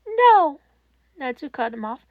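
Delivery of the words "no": a sudden, louder, higher No; sharp cutoff. A narrator's reading. Netsu cutting off Toshinori's excuse, loud and sharp. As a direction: slam the door on his excuse.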